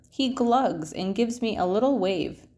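ordinary delivery, nothing marked

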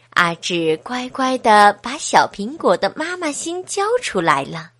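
usual speaking voice

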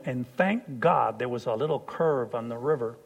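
ordinary talk

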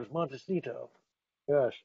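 jaw pushed out, snooty Montecito voice; underbite. Shown as a demonstration. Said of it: "you have to stick your lower jaw out".

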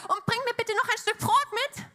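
high, lilting imitated call; calling after her. Elijah calls after the widow fetching water, high mock afterthought. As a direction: toss the request after her, pitched high. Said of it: "rief er ihr nach".

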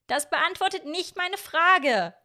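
high, slow protest called down; calling back insistently. A narrator's reading. Miorin answering Hiro from the window, insistent high call. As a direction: pitch it up and stretch it to carry.

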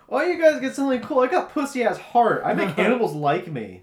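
high, loud whiny-kid impression; Heart Kid. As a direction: complain like a hammed-up cartoon kid. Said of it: "how the Heart Kid is just like".

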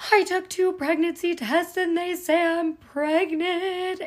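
mock hysterical crying to her mom; retold panicked crying. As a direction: replay the tearful panic, slowing near the end. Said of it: "crying hysterically".

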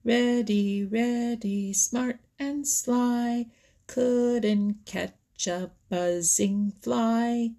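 slow, high singsong taunt. A narrator's reading. Peter Rabbit mocking Reddy Fox across the pool, taunting. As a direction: chant it like a schoolyard jeer. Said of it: "taunted Peter".

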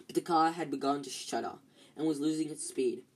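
plain reading voice

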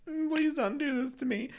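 put-on high pleading child voice; whiny plea. Child begs teacher for mercy in a high whine. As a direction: beg the teacher in a small high voice. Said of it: "So I was like".

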